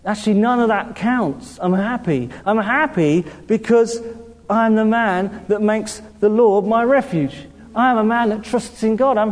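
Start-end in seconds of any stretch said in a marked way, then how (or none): none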